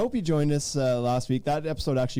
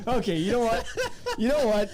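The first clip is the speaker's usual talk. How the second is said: high, laughing through the words; 8 semitones higher than usual. Real, not acted. Host laughing at his cohost's joke, giggling. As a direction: crack up while talking.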